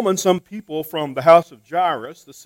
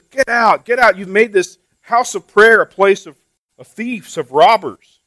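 angry shout; 4 semitones higher, 8 dB louder than usual. Retold or imitated. Jesus clearing the temple.

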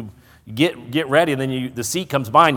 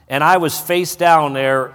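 ordinary delivery

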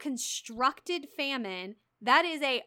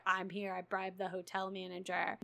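retold low confession; 5 semitones lower, 9 dB quieter than usual. Retold husband surprising her at hotel.

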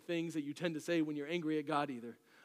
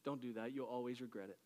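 much softer, lower warning; quoting James softly; 5 semitones lower, 9 dB quieter than usual. Acted. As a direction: drop to a hushed warning. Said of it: "James is saying".

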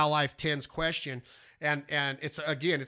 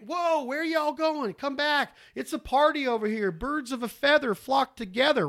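lightly voiced, high and slow, calling after the flock; voicing the duck call; 8 semitones higher than usual. Caller speaking for his call to the flock, high and slow. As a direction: coax them back like throwing a party. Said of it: "That's what you're telling those ducks".

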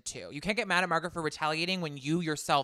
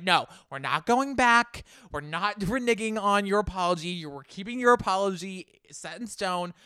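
louder, higher, firm retold objection; emphatic refusal; 3 semitones higher, 5 dB louder than usual. Co-host quoting Jackie on the apology, emphatic refusal. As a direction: put your foot down, imitating.

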